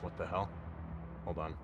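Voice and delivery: ordinary talk, nothing marked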